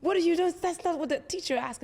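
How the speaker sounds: loud, high, mocking jab; bullying classmate. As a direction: bark it at him like a smug know-it-all. Mocking classmate voiced in story.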